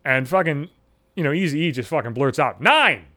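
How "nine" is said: a short, loud, proud blurted shout. Retold or imitated. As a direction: blurt it out like you're planting a flag on a summit. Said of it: "Just fucking shouts that shit out".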